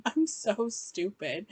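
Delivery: normal talking voice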